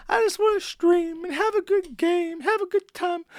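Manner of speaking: very high, whiny whimpering imitation; mock a streamer whimpering for pity; dog whimper voice. Streamer mocked for whining on stream, high whimper voice. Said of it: "you can't do the dog whimper voice".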